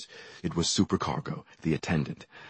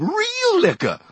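very high shrieked alarm; high panicked scream; 6 dB louder, slower than usual. A narrator's reading. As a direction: shriek it across the room. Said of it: "a woman screamed".

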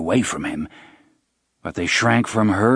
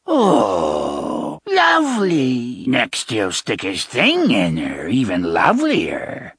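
jeering witch voice; slower than usual. Acted. Sisters jeer.

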